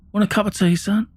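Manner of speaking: high, light offer in a dad's voice; imitating cheerful dad. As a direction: play the unsuspecting dad, casually chirpy. Unsuspecting dad offering tea.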